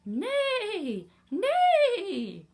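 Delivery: loud, high-pitched imitated whinny; horse neigh. A narrator's reading. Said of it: "said the horse".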